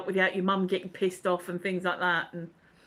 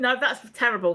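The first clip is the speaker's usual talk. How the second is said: normal talking voice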